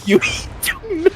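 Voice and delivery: very high, fast mocking caricature; shrill up high, rattle it off in a mock accent; squeaky caricature voice. Recalling a rude vendor's line, very high and fast. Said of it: "he said that to my face".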